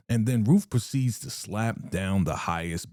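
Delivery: ordinary talk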